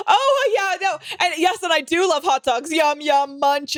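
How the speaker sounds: high, chirpy fake small-talk voice; a high, overeager party voice faking interest. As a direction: play the empty pleasant chatter. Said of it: "Yeah, like, why, like".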